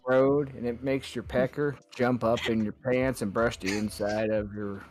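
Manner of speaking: mock truck-ad narrator with a Texan accent; put-on southern storyteller pitchman; deep southern voice. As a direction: tell it like a southern Ford commercial. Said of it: "doing this deep southern voice".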